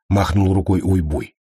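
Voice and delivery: ordinary delivery, nothing marked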